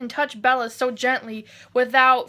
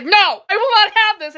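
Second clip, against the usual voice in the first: indignant Edward; 6 semitones higher, 6 dB louder than usual. Retold or imitated. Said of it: "and Edward's like".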